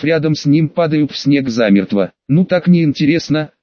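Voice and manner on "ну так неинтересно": ordinary delivery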